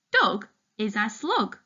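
ordinary delivery

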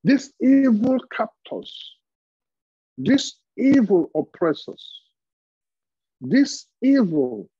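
high, slow, stressing each evil; slow emphatic denunciation. Real, not acted. Preacher to congregation, slowly hammering evil. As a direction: drag out evil like an accusation.

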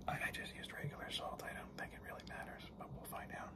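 hushed, unvoiced whisper; very quiet, confidential whisper; whispered aside. Real, not acted. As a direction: whisper it like a small confession to the viewer. Confessing a substitution as an aside.

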